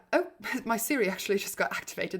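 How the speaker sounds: usual speaking voice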